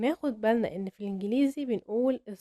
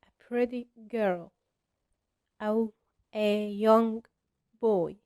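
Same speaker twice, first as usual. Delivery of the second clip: put-on "a pretty girl" said very slowly and clearly; slow model phrase; 4 dB louder, much slower than usual. Shown as a demonstration. Teacher modelling an example phrase, slow and clear. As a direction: stretch out "a pretty girl" word by word. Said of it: "يعني تقول".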